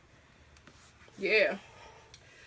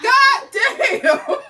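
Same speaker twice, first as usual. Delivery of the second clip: laughing curse; 12 semitones higher, 11 dB louder than usual. Real, not acted. Laughing at herself.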